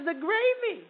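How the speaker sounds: high, huffy wife's voice; offended wife. Acted. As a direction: bristle like an insulted wife.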